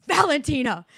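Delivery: sharp loud call of the name; yelling her name. Retold or imitated. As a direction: call her out over the crying. Yelling at crying daughter in car.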